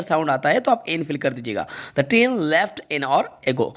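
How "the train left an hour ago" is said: very slow, word by word; slow dictation. Shown as a demonstration. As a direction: read the answer slowly for copying. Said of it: "right answer इसका N आएगा". (बहुत धीमे, शब्द-शब्द)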